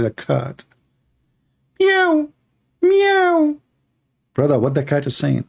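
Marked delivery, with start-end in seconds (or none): 1.8-3.6 very high mock meow; cat meow. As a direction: squeak it like a little cat. Imitating the cat.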